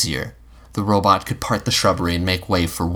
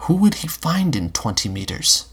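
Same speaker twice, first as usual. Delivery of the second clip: usual speaking voice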